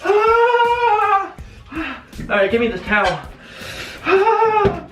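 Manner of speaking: cold yelp. Real, not acted. Drying off, freezing.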